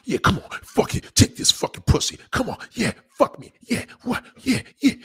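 breathy, rising hammed-up urging; pushy and hungry, mock; mock rough demand. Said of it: "They be like".

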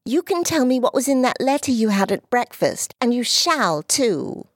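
put-on a proud little girl who won't be put off; high young girl's voice, insistent; little girl Dulcie. Dulcie demanding.

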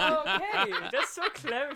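high, loud laughing reply; loud giggling "so clever". Real, not acted. Player laughs at teasing advice.

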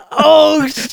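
laughing high exclamation; laughing at the mom joke. Real, not acted. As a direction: laugh out the word. Laughing reaction.

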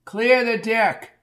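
high, drawn-out shout; screamed warning. A narrator's reading. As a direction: call it out slowly, high over the crowd. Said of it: "screamed Bucky".

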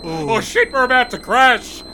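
high, loud panicked yell; mock crash panic. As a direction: yell as the chopper goes down. About to crash helicopter.

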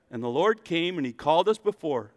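usual speaking voice

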